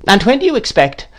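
high, abrupt great-aunt question; brusque aunt. A narrator's reading. Miss Betsy asks when the baby comes, brusque.

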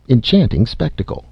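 ordinary delivery

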